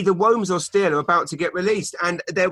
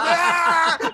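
high, laughing mock cheer; ecstatic whoop; 4 dB louder than usual. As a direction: crow it like you're flying. Reporter's crack high retold.

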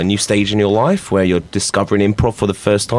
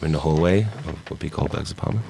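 soft scene setting; 4 semitones lower, 9 dB quieter than usual. Acted. Host sets scene.

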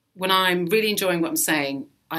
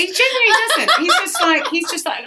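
high, quick, through laughter; amused outburst breaking into laughs; laughing agreement; 14 semitones higher, 6 dB louder than usual. Real, not acted. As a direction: agree while cracking up. Laughing agreement with listener's joke.

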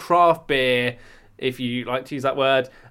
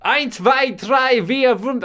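shouted German counting in a hammed-up accent; 9 semitones higher, 4 dB louder, much slower than usual. To his co-host, opening the show: loud mock German count. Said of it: "I don't have to do the accent".

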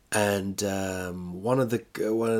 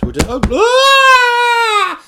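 high, drawn-out yell; mock outburst; 13 dB louder, much slower than usual. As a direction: wail it out loud, then yell the name. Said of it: "Like that, you know?".

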